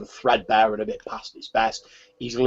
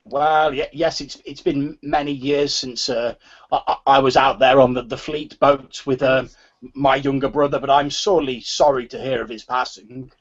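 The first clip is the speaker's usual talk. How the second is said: normal talking voice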